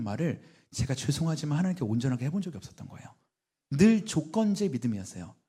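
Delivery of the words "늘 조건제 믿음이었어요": normal talking voice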